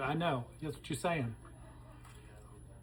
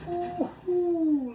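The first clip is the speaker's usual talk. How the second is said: excited ooh; 11 semitones higher than usual. Real, not acted. Tasting hot food, excited exclaim.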